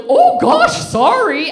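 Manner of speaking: very high, loud flustered yelp; hammed-up, squeaky startled apology; startled dad.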